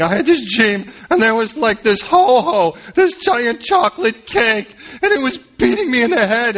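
retelling a dream. Retold or imitated. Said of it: "you tell it to someone else".

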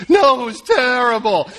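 insisting it was terrible. Retold or imitated. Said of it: "I'm like".

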